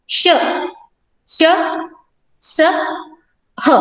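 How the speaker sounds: श स ह each said alone with a second's pause; teacher reciting श, श, स, ह slowly; sibilant recitation. Shown as a demonstration. Teacher shows the class the ऊष्म letters, slow and clear. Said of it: "देखो".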